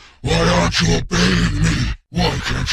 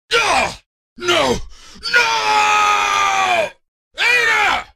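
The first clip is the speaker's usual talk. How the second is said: terrified screams; much slower than usual. Acted. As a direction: scream for your life, then cry out for Ada. Victim screaming for Ada.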